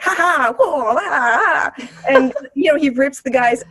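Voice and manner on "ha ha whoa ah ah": mock kung-fu yelps; playful fight cries; hammed-up martial arts noises; fighting grunts. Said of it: "He's like".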